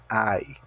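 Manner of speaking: "I" said as "ai", like the cry of pain. Shown as a demonstration. Teacher modelling English for Portuguese speakers, "I" pronounced "ai". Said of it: "como se estivesse sentindo uma dor".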